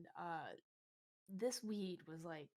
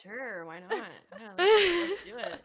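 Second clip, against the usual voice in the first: loud, eager, laughing acceptance; eager yes; 6 semitones higher, 16 dB louder, slower than usual. Retold or imitated. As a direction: jump at the offer, laughing. Eagerly accepting weed offer.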